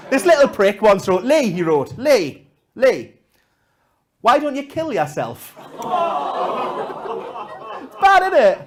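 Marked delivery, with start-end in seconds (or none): 0.1-5.5 mocking note. Quoting the boy's note.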